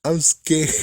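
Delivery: high put-on frightened voice; mock scared. Woman refusing Batman's helping hand, mock-frightened. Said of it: "you're like".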